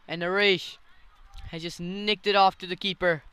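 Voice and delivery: excited, loud wicket call; cricket commentator. Real, not acted. Wicket falls, live call.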